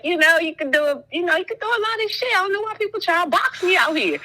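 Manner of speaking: hyped-up retelling. Retold or imitated. Friend retells boasting about himself, excited and fast. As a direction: rattle it off high and pumped up.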